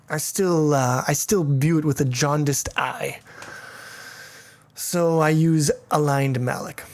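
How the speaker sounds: ordinary talk, nothing marked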